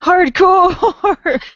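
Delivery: high, loud joking exclamation; mock hardcore cheer. Co-host echoing being called hardcore, joking high cheer. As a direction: belt it out high in mock bravado.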